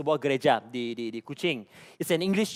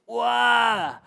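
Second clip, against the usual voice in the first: higher, drawn-out exclamation of amazement; astonished wow; 6 semitones higher, 4 dB louder than usual. Acted. Preacher reacting to pastor's English service plan, astonished. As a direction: react with a big stretched-out wow.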